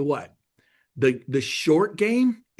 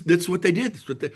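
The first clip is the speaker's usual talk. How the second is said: ordinary talk, nothing marked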